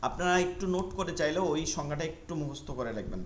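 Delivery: ordinary delivery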